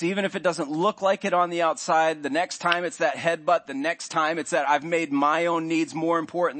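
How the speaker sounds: rising sermon intensity; preaching at full force. Real, not acted. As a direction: drive the point home from the pulpit. Preacher building to a point.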